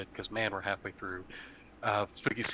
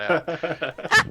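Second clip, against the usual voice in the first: laughing; 8 semitones higher, 9 dB louder than usual. Real, not acted. Laughing at a story.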